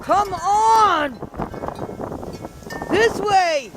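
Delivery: high, carrying call to the dogs. Real, not acted. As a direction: call the dogs from across the yard. Calling dogs across snowy yard.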